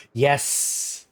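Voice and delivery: normal talking voice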